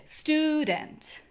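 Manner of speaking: "Student" with STU pitched high. Shown as a demonstration. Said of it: "on a higher octave somehow when I say student".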